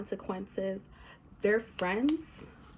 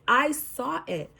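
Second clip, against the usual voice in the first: louder, slower, a higher I saw it; emphatic insistence; 5 semitones higher, 6 dB louder, slower than usual. Real, not acted. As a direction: hammer it home with conviction. Insisting on a spiritual vision.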